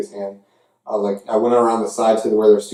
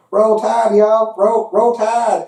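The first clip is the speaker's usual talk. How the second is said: high, mocking fan chant; mimicking rival fans chanting high; Alabama fans; 10 semitones higher, much slower than usual. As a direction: imitate gloating Alabama fans, pitched up. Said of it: "They're all like".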